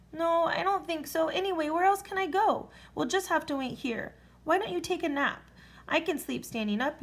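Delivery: easygoing dragon reassuring. A narrator's reading.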